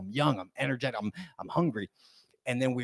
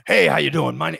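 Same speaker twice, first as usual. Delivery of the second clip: loud, booming greeting; expressive salesman; 3 semitones higher, 10 dB louder than usual. Retold or imitated. Imitating boss greeting a customer at the door, loud salesman. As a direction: hit the hello hard, toned-down imitation of a salesman. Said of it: "he's always talking like this".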